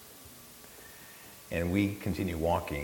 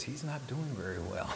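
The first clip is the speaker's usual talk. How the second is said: put-on soft, raised girl's voice; imitated girl, small and higher; sweet, soft girl; 5 semitones higher, 8 dB quieter than usual. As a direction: quietly voice a worried girl. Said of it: "sweet, soft voice of a girl".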